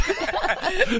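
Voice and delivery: sudden high-pitched giggle; high laugh. Real, not acted. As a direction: burst into a squeaky laugh. Host overwhelmed, laughs. (petit rire aigu)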